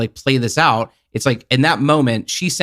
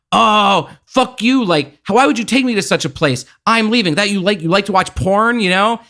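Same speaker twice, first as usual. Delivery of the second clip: mock furious outburst; 7 semitones higher than usual. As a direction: play her storming off in mock fury, voice up. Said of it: "turns into a total fucking bitch".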